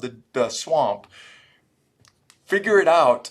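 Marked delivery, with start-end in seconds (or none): 2.5-3.3 sudden loud barked order to the squad; loud command. Retold or imitated. Retelling how he told crying soldiers, loud order. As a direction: snap them back into line, loud. Urging fellow soldiers in swamp.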